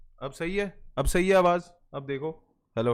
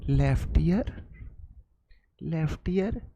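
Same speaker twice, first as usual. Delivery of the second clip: mock ASMR; 2 semitones lower than usual. Streamer pretending to run an ASMR channel, murmuring. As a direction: murmur into the left ear. ASMR left ear bit.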